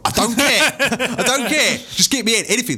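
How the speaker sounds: mock-panicked insistence; urgent plea. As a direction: beg him to just get anything.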